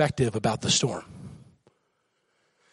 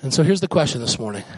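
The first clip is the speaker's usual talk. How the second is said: ordinary talk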